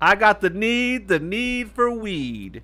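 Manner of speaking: high, very drawn-out slogan; parody catchphrase. Acted. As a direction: stretch it out like a mock movie line.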